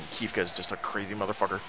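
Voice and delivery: usual speaking voice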